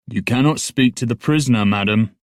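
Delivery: guard ordering a lady away, with conviction. Acted. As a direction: state it with conviction. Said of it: "He spoke with conviction".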